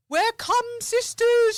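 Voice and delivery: high, loud, slow sing-song proclamation; drunk friend's announcement. Retold or imitated. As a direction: proclaim it gleefully, drawn out.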